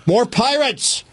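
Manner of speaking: high urgent cry of warning; alarmed warning. Acted. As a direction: sound the alarm. Spotter warns captain.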